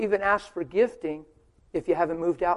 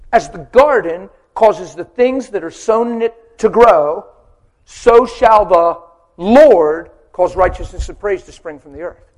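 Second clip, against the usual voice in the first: preaching scripture; 2 semitones higher, 13 dB louder, slower than usual. Acted. Reciting scripture with force.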